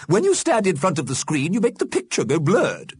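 high, complaining character voice; fussy Missus Liberty. A narrator's reading. Missus Liberty tells Mister Fletcher off, fussy.

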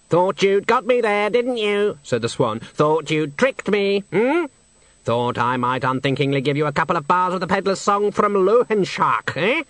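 a snooty bird sneering; haughty swan. Acted. The swan scoffing at Death's song suggestion, high and disdainful.

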